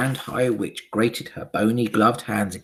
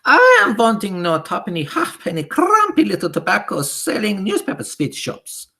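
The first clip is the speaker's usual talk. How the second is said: loud, high scornful witch voice with a foreign lilt; Grand High Witch shouting; 12 semitones higher, 9 dB louder than usual. Acted. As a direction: sneer at the cheap shops. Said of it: "shouted the Grand High Witch".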